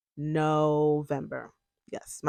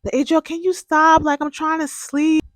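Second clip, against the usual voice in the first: higher, louder whiny complaint; annoyed roommate; 10 semitones higher, 7 dB louder than usual. Retold or imitated. Imitating roommate Melissa complaining about printing at midnight, higher and whiny. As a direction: mimic the roommate snapping about the printer noise. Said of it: "Melissa starts complaining".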